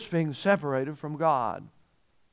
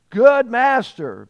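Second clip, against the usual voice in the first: louder, higher, drawn-out flowery address; flowery flattery; 6 semitones higher, 7 dB louder, slower than usual. Acted. Rich young ruler greeting Jesus, flowery and flattering. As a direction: lay on the flattery, slow and high. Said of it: "flowery speech".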